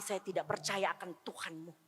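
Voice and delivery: put-on whispered child's final words. Child telling mother he rejects her God, whispered. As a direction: breathe it out as a whisper. Child's hurtful reply quoted.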